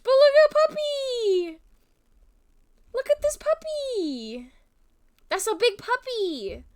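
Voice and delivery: put-on very high baby-talk squeal over a puppy; puppy cooing. Showing her viewers a puppy photo, high cooing baby talk. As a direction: coo at the photo like it's a real puppy.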